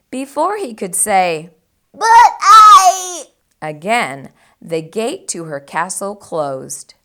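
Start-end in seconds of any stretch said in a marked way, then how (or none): 1.9-3.3 high startled "But I"; flustered pig; protesting piglet. A narrator's reading. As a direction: try to object.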